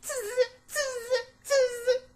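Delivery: high squeaky monkey chatter; mock him like cheeky monkeys. A narrator's reading. Monkeys mocking the peddler with high chattering. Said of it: "the monkeys ... said".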